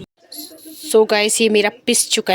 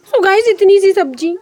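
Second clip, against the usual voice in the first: surprised; 10 semitones higher, 11 dB louder than usual. Real, not acted. Exclaims at tiny portion.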